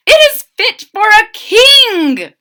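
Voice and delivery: high, loud royal praise; soldier voice. Acted. Third soldier declaring it fit for a king, high and loud. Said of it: "said the third".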